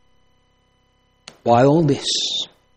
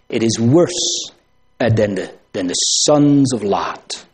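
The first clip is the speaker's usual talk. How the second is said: ordinary talk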